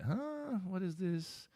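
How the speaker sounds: put-on very high, puzzled baby voice; baby's confusion voiced. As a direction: squeak it like a baffled infant. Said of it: "He's like".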